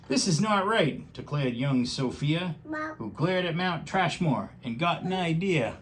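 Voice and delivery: lively rhyming storybook read; reading to a child. Acted. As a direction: read it aloud with bouncy rhythm. Reads picture book aloud.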